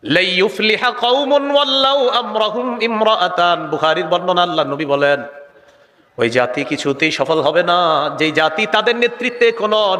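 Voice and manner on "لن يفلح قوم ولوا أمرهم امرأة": high, slow, melodic recitation; Arabic recitation. Real, not acted. Preacher quoting the Prophet to the congregation, high chanted recitation. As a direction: recite the hadith in long drawn syllables.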